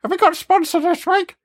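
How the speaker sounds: very high parody of co-host; mock Carol. Host feeds himself sponsor questions as Carol, mock squeaky voice. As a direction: squeak it out, mimicking your co-host. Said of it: "think I sound like that".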